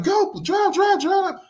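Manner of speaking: mock-frantic order to flee; panicked urging. Scared passenger telling the Uber driver to speed off, imitated panic. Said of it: "And she's like".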